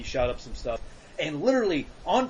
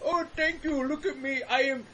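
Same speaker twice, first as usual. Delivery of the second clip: mock big American man; 5 semitones higher, 4 dB quieter than usual. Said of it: "They're like".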